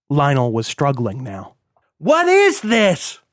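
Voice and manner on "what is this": very high, drawn-out cry; panicked shriek. A narrator's reading. Struggling son held by his captors, panicked shriek. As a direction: shriek in helpless terror. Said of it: "he shrieked".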